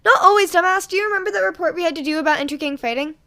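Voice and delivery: loud, fast barking comeback; barked retort. A narrator's reading. Hero student barking back at a classmate, loud and fast. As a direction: snap back hard at the other kid. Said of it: "A hero student barked back".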